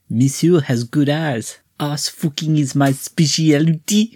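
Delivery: slow, lightly put-on French accent; a hint of a French hooker purring; mock French accent. Podcast host voices the French woman's reply, mock French accent. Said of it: "French woman responds with".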